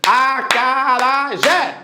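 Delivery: slow, evenly chopped A-ca-ra-jé; syllable by syllable. Shown as a demonstration. Teacher mocking the clapping method, slow syllable-by-syllable. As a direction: beat out each syllable as if clapping it. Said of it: "você vai bater palma".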